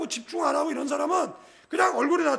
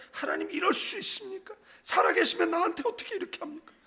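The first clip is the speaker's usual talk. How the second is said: a lightly voiced scowling complainer, pitched up; mock whiny prayer of a frowning believer; grumbling complaint prayer; 6 semitones higher than usual. Pastor mimics a frowning member complaining to God, mock whine. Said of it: "어떤 분은 인상 오만싹 다".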